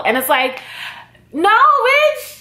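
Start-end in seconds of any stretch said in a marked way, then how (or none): 1.3-2.4 high, loud, punchy no; sassy retort. Retold or imitated. Answering viewers who doubt her pronunciation, sassy and loud. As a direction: snap it back at them with attitude.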